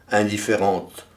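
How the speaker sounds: ordinary delivery, nothing marked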